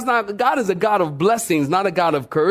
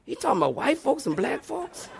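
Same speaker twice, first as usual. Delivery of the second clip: mock shocked listener; 9 dB quieter than usual. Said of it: "Y'all like".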